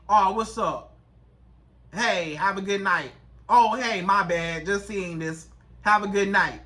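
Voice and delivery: low, dry late reply; offhand, like you barely care; indifferent. Acted. Woman texting back late at night, indifferent. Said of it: "act like you don't even care".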